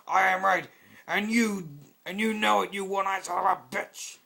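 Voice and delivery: high, loud furious yelling; angry shout. Acted. Soldier yelling at Demoman before tackling him, furious. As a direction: scream the insult at him.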